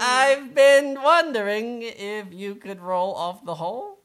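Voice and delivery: high, slow, loud mole voice; mole's punchline. Acted. Mole delivering his pun to the rocks, high and drawn out. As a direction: draw the punchline out slowly, up high.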